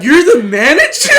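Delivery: high, loud, laughing exclamation; mock disbelief. Customer doubting the manager, incredulous.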